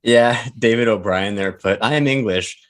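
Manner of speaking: ordinary talk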